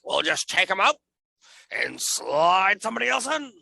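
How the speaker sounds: a hint of the old WWE chairman; Vince McMahon voice. Co-host asks if that was his Vince McMahon voice. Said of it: "Was that your Vince McMahon voice?".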